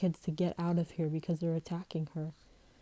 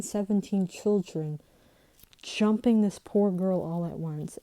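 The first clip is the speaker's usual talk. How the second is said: normal talking voice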